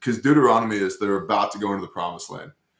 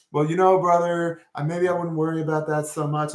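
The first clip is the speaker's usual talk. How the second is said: higher, gentle, hedging put-on voice; mock timid believer; 7 semitones higher than usual. The speaker mocking a meek brother's idea of peace, in a higher hedging voice. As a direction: lightly mimic a meek churchgoer.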